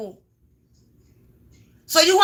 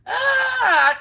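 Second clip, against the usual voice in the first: squeal; 11 semitones higher, 3 dB louder than usual. Real, not acted. Reacts to snitching.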